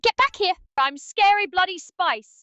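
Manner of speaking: high, loud, slow British shout; drunken British yell. Acted. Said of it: "I said".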